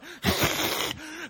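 a breathy hissing snarl; imitated hiss. Preacher imitating the possessed hitchhiker, breathy hiss. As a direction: let the hiss rasp out like a demon. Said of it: "just hisses and snarls".

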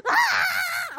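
very high, loud mock wail; imitated child's scream. Son bent over fallen apricots, mock screaming wail. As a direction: shriek like a child in despair. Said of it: "he is sobbing it".

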